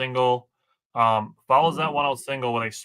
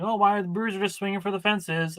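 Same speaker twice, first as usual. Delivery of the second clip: high, whiny mock complaint; mocking complainers; 8 semitones higher than usual. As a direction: sneer it like a fed-up fan. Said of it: "I saw people ... complaining".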